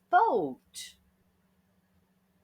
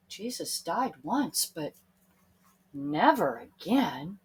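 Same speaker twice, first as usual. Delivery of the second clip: usual speaking voice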